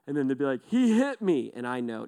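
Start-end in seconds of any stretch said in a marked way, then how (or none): 0.7-1.5 put-on high, loud childish complaint; a hint of a complaining child; whiny kid. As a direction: play the aggrieved little kid. Child voiced in a story.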